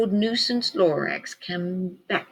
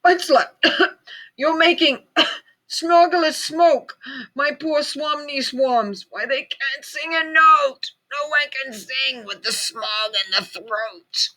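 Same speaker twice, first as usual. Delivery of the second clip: high croaky voice with coughs; coughing croak; 7 semitones higher than usual. A narrator's reading. Lorax scolding the Onceler about smog, coughing croak. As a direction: croak the complaint between coughs. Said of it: "he cried with a kerfuffleish croak".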